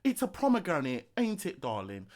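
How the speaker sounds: mock London accent; London British accent. Showing friend how Londoners all speak, mock London accent. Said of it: "that's like the freaking London British".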